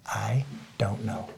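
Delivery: slow whispered confession; hushed admission. Retold or imitated. Admitting he never says it, slow whisper. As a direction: barely let the words out.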